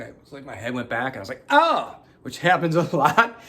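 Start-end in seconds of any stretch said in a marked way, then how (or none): 1.5-2.0 startled yelp. Retold or imitated. Sudden chill, head went back.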